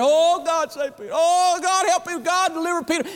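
high, pleading cries to God; voicing a church crying out to God in prayer; desperate pleading prayer. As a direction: cry out high for God to save Peter.

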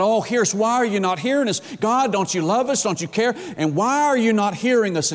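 high-pitched, complaining appeal to God; imitated pleading. Worshippers asking God why He won't hear, acted pleading. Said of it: "They're pleading with God".

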